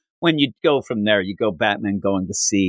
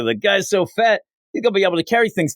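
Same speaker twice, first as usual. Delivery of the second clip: dummy voice; 7 semitones higher, faster than usual. Retold or imitated. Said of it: "He even says later".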